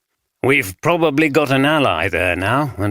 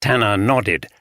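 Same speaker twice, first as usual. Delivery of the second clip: ordinary delivery, nothing marked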